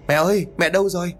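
high, fast call; calling out loud. A narrator's reading. Son calling for his vanished mother, high and fast. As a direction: shout it out searching for her. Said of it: "rồi gọi lớn".

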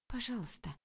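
soft, high pleading whisper. A narrator's reading. Narrator whispering to soften the angry lecturer. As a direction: beg softly for pity. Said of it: "прошептала я".

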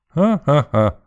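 high, voiced "ha-ha-ha" laugh. A narrator's reading. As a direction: burst out in a short acted laugh. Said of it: "рассмеялся".

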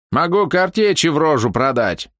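put-on high, threatening old man. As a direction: snap at him like an armed old man. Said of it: "ткнул дед стволом мне в лицо".

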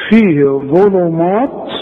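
فيه ظلمات drawn out slowly; slow recitation. Shown as a demonstration. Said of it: "ما تقولش". (فيه ظلمات بتلاوة بطيئة)

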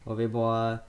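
usual speaking voice

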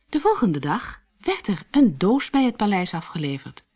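plain reading voice

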